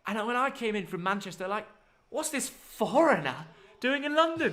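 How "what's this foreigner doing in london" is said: slow, higher sneering Londoner voice, laughing at the end; mock sneer at the new northern lad. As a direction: put on a snooty southerner scoffing at a northerner. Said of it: "That's what they sort of said to me".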